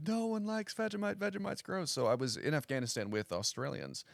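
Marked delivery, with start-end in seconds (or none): 0.1-1.9 high, whiny complaint; bewildered persona. Shown as a demonstration. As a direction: complain like it truly offends you. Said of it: "the joke is".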